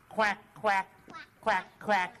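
quack, quack, like a duck. Shown as a demonstration. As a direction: show the kids how a duck quacks. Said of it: "ducks quack like this".

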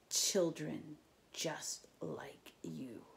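slow, creepy whisper; menacing whisper. A narrator's reading. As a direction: hiss it to scare them. Tantalus menaces campers.

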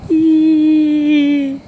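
high squeal of delight; excited squeal. Real, not acted. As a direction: squeal with glee.

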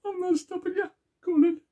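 very high, comic squeaky builder voice. Retold or imitated. Retelling the Tim Vine joke, squeaky builder voice.

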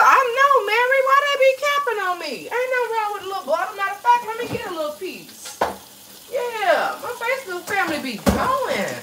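high-pitched mock grievance; high put-on voice. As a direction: pipe up high and sassy, fussing to Mary.

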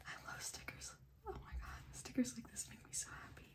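very soft, breathy near-whisper; hushed delight. Real, not acted. Collector admiring stickers, hushed delight. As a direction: whisper it to yourself, awed and happy. Quietly gushes over stickers.

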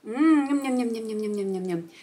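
playful "yum-yum" eating noises, falling and fading; pretend munching. Pretends to eat the apple.

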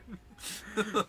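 short, high laugh; amused burst of laughter. Real, not acted. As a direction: laugh at your own blunder. Laughs at own yelling.

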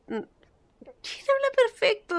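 mock-indignant high drawl; exaggerated retort. Shown as a demonstration.

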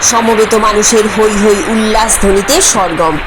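usual speaking voice

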